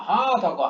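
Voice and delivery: "হা" held long before "থকো"; drawn-out syllable. Shown as a demonstration. Teacher showing how far to pull, "হা" long-drawn. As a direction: stretch out the "হা" of "হা থকো". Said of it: "হাটাকে কত দূর টানছি আমি".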